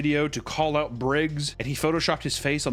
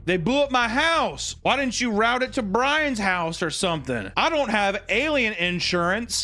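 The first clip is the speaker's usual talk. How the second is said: mock indignant rant; 7 semitones higher than usual. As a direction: complain like a wronged homeowner. [name] complaint voiced.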